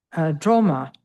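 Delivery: ordinary talk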